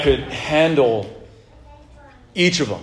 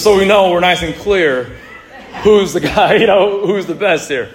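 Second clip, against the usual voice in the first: louder, higher, laughing through the brag; laughing boast; 4 semitones higher, 6 dB louder than usual. Real, not acted. Preacher laughing about showing the kids who's best. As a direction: gloat with a laugh in it.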